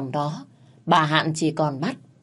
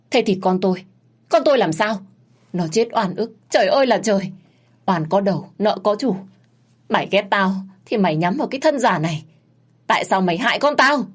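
higher, louder wailing accusation; grief-stricken rage; 4 semitones higher, 4 dB louder than usual. Acted. Mother raging at the maid over her son, grief-stricken. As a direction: lament and blame at full voice. Mother lamenting, attacking Hương.

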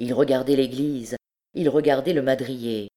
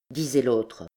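ordinary delivery

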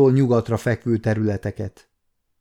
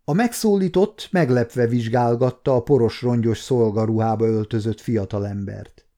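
ordinary delivery, nothing marked

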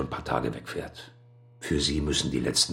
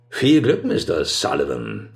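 sarcastic; 5 dB louder than usual. Acted. Sarcastic jab at rival.

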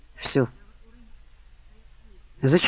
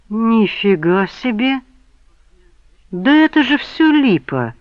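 higher, louder, slowed outrage; indignant exclamation; 7 semitones higher, 4 dB louder, slower than usual. A narrator's reading. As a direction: spit out the disbelief loudly. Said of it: "возмущённо воскликнул он".

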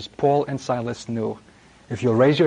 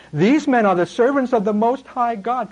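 higher, shrieking mock demon girl voice; shrill possessed girl; 8 semitones higher than usual. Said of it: "freaky, shriekish, shrill intonation".

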